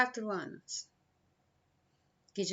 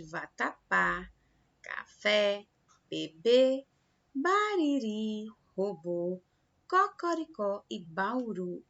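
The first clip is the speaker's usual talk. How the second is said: vatapá, café, bebê, bariri, robô, cocoricó, bauru, each weighted on its last syllable; teacher's list from vatapá to bauru, final syllables drawn out; stress on final syllables; slower than usual. Shown as a demonstration. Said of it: "elas são as últimas".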